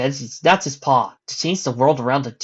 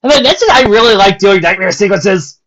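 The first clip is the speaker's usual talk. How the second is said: loud, high, delighted; gleeful aside; 8 semitones higher, 12 dB louder than usual. Real, not acted. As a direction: gloat about the nightmare.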